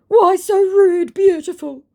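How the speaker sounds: very high, slow, loud mock wheedle; mock his hurt sweet-talk; wheedling impostor. Impostor asking why she's so rude, mock wheedling.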